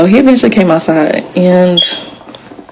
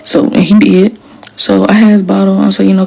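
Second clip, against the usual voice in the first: ordinary delivery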